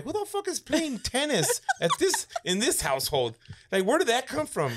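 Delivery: high, incredulous outburst; baffled outrage. Retold or imitated. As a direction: cry out in disbelief. Kid baffled by tennis racket hit.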